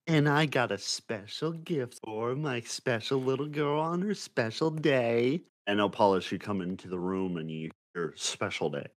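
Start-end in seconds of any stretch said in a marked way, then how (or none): 0.1-5.4 high, sweet motherly voice; doting mother. Acted. Mother surprising her daughter on her birthday, sweet doting voice. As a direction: coo like a proud mum.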